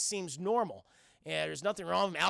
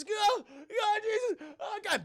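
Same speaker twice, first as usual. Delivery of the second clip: high, panicked pleading; hammed-up cries of someone spinning in midair; mock terror; 13 semitones higher, 4 dB louder, slower than usual. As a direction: wail it high in mock fright. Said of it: "this is terrifying".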